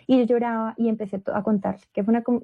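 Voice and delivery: ordinary delivery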